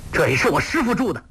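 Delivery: high, fast indignant retort; angry protest. A narrator's reading. Guo Jing defending his master's shelter, angry protest. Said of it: "郭靖大怒".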